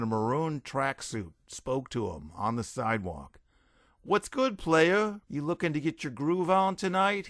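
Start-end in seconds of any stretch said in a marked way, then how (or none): 4.0-7.3 high-pitched hustler come-on; street hustler. A narrator's reading. Street hustler accosts Derek.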